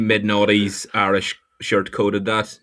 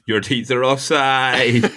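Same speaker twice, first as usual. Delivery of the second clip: slow drawn-out caption; much slower than usual. Shown as a demonstration. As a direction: stretch it out slowly, savouring each word. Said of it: "offside with about five eyes".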